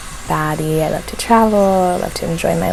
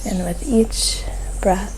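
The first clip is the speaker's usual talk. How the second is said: guided meditation; 5 dB quieter than usual. Real, not acted. Host guiding listeners to soften their focus, guided meditation. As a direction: ease listeners gently into calm, phrase by phrase.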